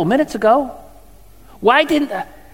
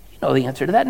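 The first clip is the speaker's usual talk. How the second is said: ordinary talk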